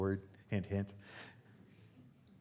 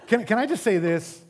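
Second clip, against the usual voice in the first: higher, louder with a laugh; laughing exasperation; 8 semitones higher, 7 dB louder than usual. Real, not acted. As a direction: laugh through the question. Laughing rant.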